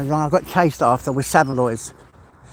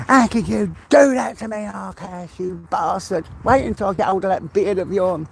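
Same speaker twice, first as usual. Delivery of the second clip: mock drama anger; 3 semitones higher than usual. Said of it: "as far as I can go with the drama".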